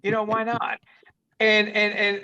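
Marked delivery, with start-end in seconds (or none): 0.0-0.8 whispered. Retold or imitated. Repeats impulse, unvoiced.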